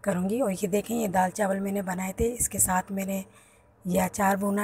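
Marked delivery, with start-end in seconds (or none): none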